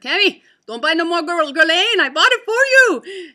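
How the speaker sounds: a friend's excited French accent, hammed up loud and high; imitating a French accent. Joelle telling Tammy not to buy Guerlain, loud mock French accent. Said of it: "with that beautiful French accent".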